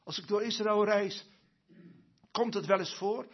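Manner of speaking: normal talking voice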